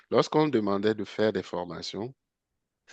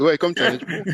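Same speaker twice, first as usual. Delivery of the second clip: laughing, higher and louder; amused; 9 semitones higher, 7 dB louder than usual. Real, not acted. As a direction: say it through real laughter. Joking about sponsors, both laughing. (amusé, en riant)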